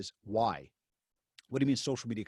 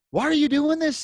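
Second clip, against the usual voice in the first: high, louder exasperated why; 11 dB louder than usual. Retold or imitated. Pressing the client in loud exasperation. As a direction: throw up your hands, pitched up.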